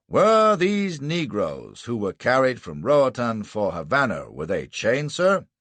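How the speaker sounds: slow, loud start to a formal question; probing prosecutor. A narrator's reading. Prosecutor questions the ship's witness, probing. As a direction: put it pointedly, rising on the last word.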